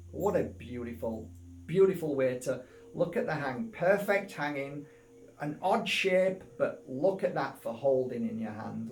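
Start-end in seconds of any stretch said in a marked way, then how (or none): none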